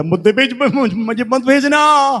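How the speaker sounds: stumbling plea climbing high; desperate begging. Acted. Girl grips Jesus's feet pleading to stay, high and desperate. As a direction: act out a frantic high-pitched refusal.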